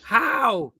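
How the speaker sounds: high, loud, incredulous cry of how; imitating a chat viewer who can't believe the score; disbelieving how. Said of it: "Kindred's like".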